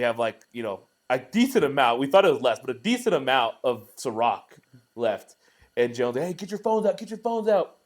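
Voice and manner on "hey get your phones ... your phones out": high-pitched excited call to the group. Retold or imitated. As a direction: imitate a friend rallying everyone. Friend calls out to the group.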